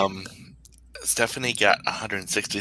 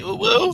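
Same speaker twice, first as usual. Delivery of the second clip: spooky woo. Acted. Spooky mock sound.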